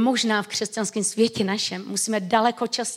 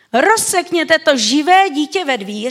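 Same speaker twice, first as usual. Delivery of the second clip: stern decree; 4 semitones higher, 7 dB louder than usual. Retold or imitated. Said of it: "řekl král".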